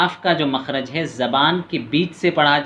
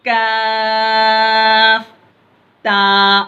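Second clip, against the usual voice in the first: slow, high, louder chanted lam alif; drawn-out recitation; 6 semitones higher, 5 dB louder, much slower than usual. Shown as a demonstration. Teacher demonstrates the lesson's letters to pupils, slow chanted and louder. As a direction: hold लाम very long, then a falling अलिफ. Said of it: "सख्ती नहीं करेंगे".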